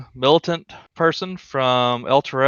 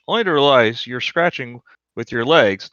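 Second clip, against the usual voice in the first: normal talking voice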